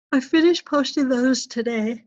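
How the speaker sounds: talking through a yawn; words stretched by a yawn. Real, not acted. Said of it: "Sorry for, for yawning at you".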